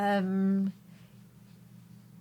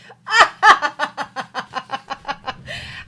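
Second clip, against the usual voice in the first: high, loud burst into a long rattling laugh; helpless laughter; 6 semitones higher, 5 dB louder than usual. Real, not acted. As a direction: laugh on and on in a steady stutter. Cracks up at the game name.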